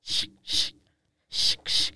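unvoiced "shh" bursts like paws on a door; imitated dog scratching. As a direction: scratch at the door with short shushing sounds. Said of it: "he would go and scratch".

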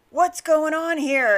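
loud, high-pitched mock yell; exasperated outburst, acted in retelling; imitated yell. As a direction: mimic an upset shopper yelling out. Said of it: "yelled it out loud".

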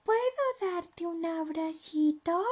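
high, slow little voice; shy small creature asking; tiny spider. Acted. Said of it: "susurró la araña".

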